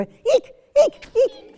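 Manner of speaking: very high, loud eek; high mouse squeak. Shown as a demonstration. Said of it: "You go".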